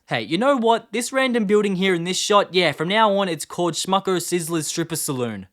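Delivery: loud, high mock proclamation; excited. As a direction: announce it like a grand decree. Jokingly renames a building loudly.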